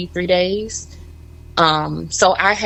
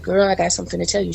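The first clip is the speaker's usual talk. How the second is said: quick confession; much faster than usual. Retold or imitated. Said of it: "I said".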